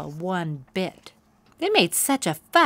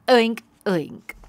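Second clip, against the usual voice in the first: louder, higher pig mimicry; mocking oinks; 4 semitones higher, 7 dB louder than usual. A narrator's reading. Pony mocking Poniella with pig noises, jeering. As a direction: oink at her to mock. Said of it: "said Plumpkin".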